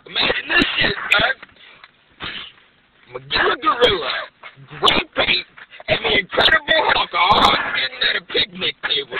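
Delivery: loud, high storyteller build-up; hyped. Acted. Comedian setting up a wild story, loud and excited. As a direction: pitch the absurd scene with big energy.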